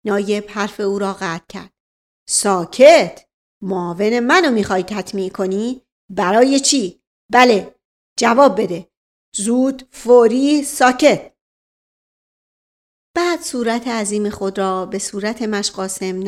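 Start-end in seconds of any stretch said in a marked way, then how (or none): 2.3-11.3 loud, rapid-fire interrogator; bully him with questions; barking police officer. Acted. Officer grilling the old servant, harsh and loud. Nayeb scolds and demands.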